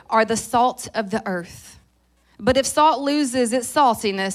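loud, declarative Bible reading; scripture reading. Real, not acted. Preacher reading Matthew five to the congregation, loud and declarative. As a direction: proclaim the verse to the room. I'm a fast reader.

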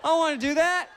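high, loud panicky yelp; panicked refusal. Retold or imitated. Husband refusing wife's camera request, panicked high yell. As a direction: shout it in a panic.

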